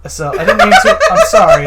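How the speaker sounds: high squeaky apology, cracking up; loud laughing falsetto sorry; laughing through a mock apology. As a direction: try to apologise but giggle it out. Voicing the shaken girl, laughing.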